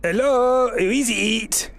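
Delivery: high, startled call from behind a door; npc at the door. Acted. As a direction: answer the door, suspicious.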